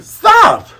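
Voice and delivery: put-on high, loud, half-laughing girl voice; high indignant voice breaking into laughs; upset Kaya. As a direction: scold Mary in disbelief. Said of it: "And Kai's like".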